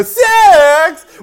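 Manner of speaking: very high, loud nasal shout; whiny nasal voice. Retold or imitated. Student speaker re-enacting his pulpit announcement, whiny nasal voice. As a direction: blurt it out in a horrible whine. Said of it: "I can still hear my voice".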